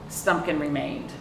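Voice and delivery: ordinary delivery, nothing marked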